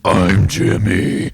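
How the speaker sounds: slow, loud odd yell; weird yell. Acted. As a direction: bellow it slowly in a strange voice. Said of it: "And in an equally weird voice, I yell".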